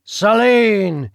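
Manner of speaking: a loud, sharp call of her name; loud warning. Acted. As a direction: warn her to stop. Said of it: "he warned again".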